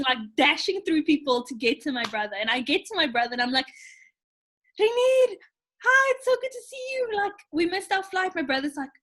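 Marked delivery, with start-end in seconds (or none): 4.8-8.4 high, loud rush of relief; high, loud relieved hello; breathless greeting. Retold or imitated. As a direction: gush it out as you reach him. Greeting brother after panic.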